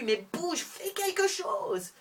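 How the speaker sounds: high, strained yell, slowing on the last words; crying out at oneself. Retold or imitated. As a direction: re-enact an urgent cry to yourself. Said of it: "je me crie dessus". (se crier dessus)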